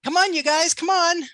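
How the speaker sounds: loud, high coaxing; urging. Real, not acted. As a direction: hurry the crowd along. Urging the audience.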